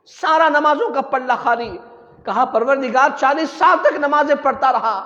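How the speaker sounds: loud, impassioned sermon; full-force preaching. Real, not acted. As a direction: hammer out the warning to the faithful.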